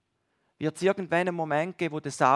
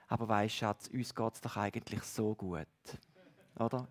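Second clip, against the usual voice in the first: gentle reassurance; 6 semitones lower, 9 dB quieter than usual. Retold or imitated. Wife gently calms angry husband.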